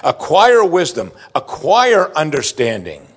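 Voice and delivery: slow, emphatic ACQUIRE in both phrases; insistent fatherly charge. Acted. Preacher voicing what Solomon heard from David, insistent charge. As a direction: drive home each "acquire" with weight. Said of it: "what David heard again and again".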